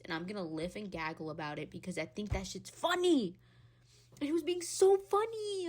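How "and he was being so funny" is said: high, loud gush. Real, not acted. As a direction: squeal it with delight. Gushing about film student.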